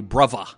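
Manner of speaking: bruvva with an f-like v for th; Cockney-style bruvva. Shown as a demonstration. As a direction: say bruvva, th turned to v. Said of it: "speaks in this lower class British accent".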